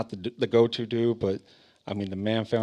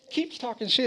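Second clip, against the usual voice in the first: ordinary talk, nothing marked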